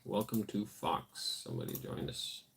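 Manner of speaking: normal talking voice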